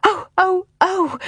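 put-on high little girl's gasping cries; high, acted breathless "oh"s; gasping cry. A narrator's reading. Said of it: "cried Jane as she gasped for breath".

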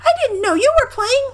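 put-on very high squeaky child voice; Roo. A narrator's reading. Roo talks to floating Eeyore, high squeak. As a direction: pipe it up tiny and high. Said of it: "said Roo".

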